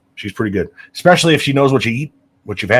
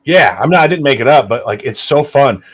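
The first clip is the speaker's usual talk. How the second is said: ordinary talk, nothing marked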